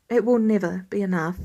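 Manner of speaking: usual speaking voice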